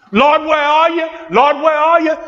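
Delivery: high-pitched imitation of a doubting believer; high, plaintive mimicry; mock pleading. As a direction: call out to the Lord in mock distress. Mimics believers doubting God's promise.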